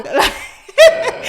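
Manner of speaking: high loud burst of laughter. Real, not acted. Wife laughing at husband's excuse, high burst.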